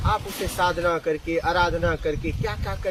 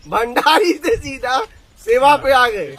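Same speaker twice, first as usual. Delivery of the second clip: amused laughing; 7 semitones higher, 10 dB louder, slower than usual. Real, not acted. Amused at their sudden turnaround.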